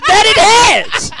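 very high, hammy hero voice; the Guardian. Acted. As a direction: answer the nemesis in a squealing theatrical pitch. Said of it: "the Guardian character".

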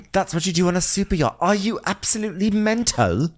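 high-pitched exasperated mimicry; hammed-up outraged rant; mock losing it. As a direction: explode in disbelief, imitating. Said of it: "Frasier finally loses his mind".

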